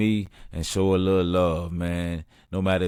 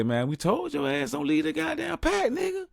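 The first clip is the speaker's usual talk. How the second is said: much higher, exasperated scold; scolding told-you-so. Retold or imitated. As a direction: tell the robbed friend he left the pack. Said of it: "I say".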